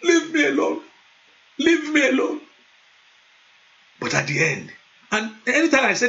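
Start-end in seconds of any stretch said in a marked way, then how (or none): 0.0-2.4 higher, rising to a half shout; sulky child pushing God away; repeated petulant protest; exasperated brush-off. Retold or imitated. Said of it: "Sometimes I will say".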